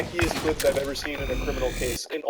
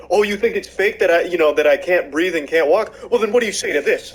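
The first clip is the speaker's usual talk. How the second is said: mocking the man; 2 semitones higher, 8 dB louder than usual. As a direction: ham up his defiant dare, louder. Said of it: "that whole segment where he's like".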